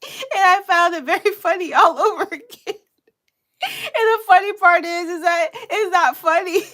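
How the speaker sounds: high, squeaky talk through real laughter; laughing helplessly. Real, not acted. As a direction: fight to get the words out while cracking up. Cracking up at her crying imitation.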